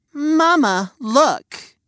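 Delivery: put-on high sneering girl voice; bratty daughter. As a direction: whine it like a spoiled girl. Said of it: "she pointed with a sneer".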